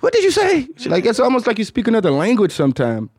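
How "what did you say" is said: high-pitched exasperated groan into question; mock a fed-up girlfriend, pitched way up; hammed-up high exasperation; imitating exasperated girlfriend. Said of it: "She's like".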